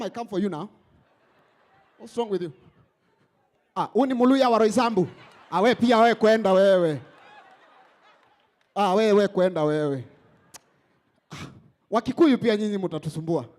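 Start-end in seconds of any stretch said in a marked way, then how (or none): 3.7-13.5 loud mocking shout in Swahili; hammed-up yell, laughing through it; shouted Swahili taunt. Mocking Kenyan women shouting at men.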